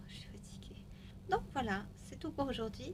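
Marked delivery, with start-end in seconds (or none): 0.1-0.8 tired hushed whisper. Real, not acted. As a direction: whisper softly, winding down. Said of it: "Je chuchote pour pas le réveiller".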